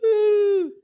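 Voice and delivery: loud, long, very high 'ooh'; high hooting squeal. Acted. Comic imagining being remembered for this, mock high squeal. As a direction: let out a sustained high-pitched squeal. Mimics reaction to pepper spray.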